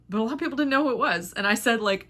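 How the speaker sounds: ordinary talk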